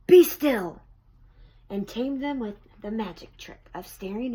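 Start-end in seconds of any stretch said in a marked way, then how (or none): 0.1-0.8 a loud, higher command; commanding shout. A narrator's reading. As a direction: order the monsters to freeze. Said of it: "Till Max said".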